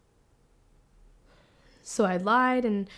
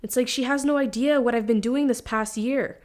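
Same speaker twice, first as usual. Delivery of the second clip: louder, higher, indignant outburst; pissed; 4 semitones higher, 5 dB louder than usual. Acted. Daughter venting that mom has no idea, pissed. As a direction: vent the resentment, pushing every word. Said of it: "I'm pissed".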